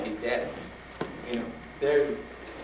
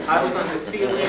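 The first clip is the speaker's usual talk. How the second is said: ordinary delivery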